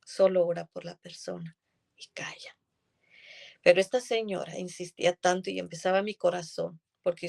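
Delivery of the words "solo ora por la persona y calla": whispered divine voice. Retold or imitated. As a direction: whisper it like a voice inside. God's quiet instruction quoted. (susurro suave de una voz interior)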